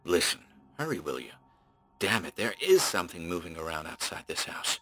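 quiet tense pleading; hushed, edgy urgency; edged. Acted. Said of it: "Then it became edged again".

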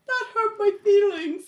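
small high-pitched mock-wounded voice; adorable hurt voice. Co-host imitating Ling after Mark's rejection, adorable hurt voice. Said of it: "super adorable when she was like".

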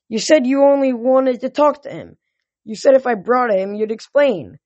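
high-pitched, insistent complaint; pleading protest. A narrator's reading. Son holding father to his word, pleading.

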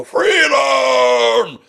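shouted high and loud; Braveheart battle cry. Retold or imitated. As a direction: bellow it like a war cry. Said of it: "like Gibson did in the film".

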